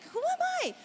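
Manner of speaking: high-pitched retold questioning; mock despairing high cry; exasperated self-doubt. Husband doubting himself to his wife, exasperated.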